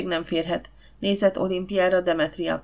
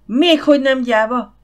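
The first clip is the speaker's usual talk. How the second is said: high, loud outraged yell; howling; 6 semitones higher, 8 dB louder than usual. A narrator's reading. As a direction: bellow it back in disbelief. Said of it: "sűvöltötte Gifford".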